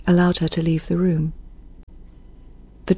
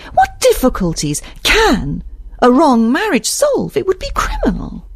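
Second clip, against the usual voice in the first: outraged Isabel; 8 semitones higher than usual. Acted. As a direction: challenge him in appalled disbelief. Reader voices outraged Isabel.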